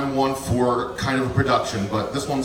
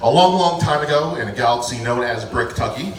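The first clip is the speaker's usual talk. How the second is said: storybook telling, louder start; fairy tale narration; 3 semitones higher, 4 dB louder than usual. Acted. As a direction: tell it like a bedtime fairy tale. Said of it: "telling a magical short story".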